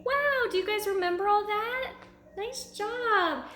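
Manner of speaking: high, bright teacher praise to the class; excited praise. Acted. Teacher praising the class for remembering, excited and high. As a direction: cheer the kids on, high and quick.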